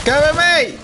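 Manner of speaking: high urgent yell; cover me shout. Real, not acted. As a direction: call loudly for backup. Calling for cover in firefight.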